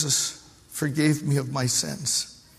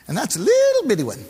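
high, quick mocking voice for the little bitty one; tiny mocking squeak; mocking little sin; 11 semitones higher, 6 dB louder, faster than usual. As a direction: squeak out the small sin dismissively. Mocking small sin, high fast.

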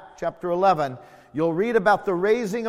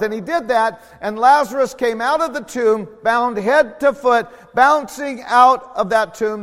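louder preaching that builds; fiery preaching; 5 semitones higher, 7 dB louder than usual. Acted. Preacher building to the miracle, loud and fervent. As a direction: drive the story home to the church.

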